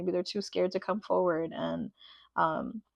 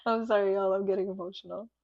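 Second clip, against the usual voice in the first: ordinary talk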